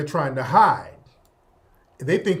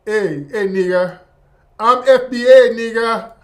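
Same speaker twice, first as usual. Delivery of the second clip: loud, cocky imitation of a poser online; mocking a fake FBA; 5 semitones higher, 7 dB louder than usual. Host mocking online posers claiming to be FBA, loud cocky parody. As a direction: ham up a pretender bragging he's FBA. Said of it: "A lot of these folks are online".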